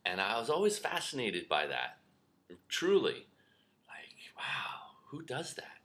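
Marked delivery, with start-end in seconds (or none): 3.9-5.8 whisper. Real, not acted. Wondering aloud in awe.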